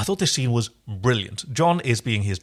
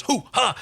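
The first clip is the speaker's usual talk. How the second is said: mock battle cry; 11 semitones higher than usual. Mimicking Dargo's combat roll.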